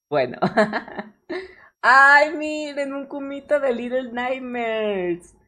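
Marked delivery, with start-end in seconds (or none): 1.8-5.3 excited. Real, not acted. Excited about a new drawing.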